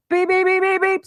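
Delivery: high imitated beeping; mock electronic beep; alarm beeps. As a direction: chirp it like a smoke alarm.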